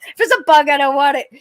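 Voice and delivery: loud, high insistent refusal; loud protest. Retold or imitated. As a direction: push the bug away with your voice. Retold reply to spirit.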